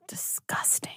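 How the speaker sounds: very soft whispered mumble under the breath; mumbled. Retold or imitated. Son sees chicken again, whispers it under his breath. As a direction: barely voice it, a muttered aside.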